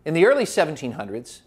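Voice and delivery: ordinary talk, nothing marked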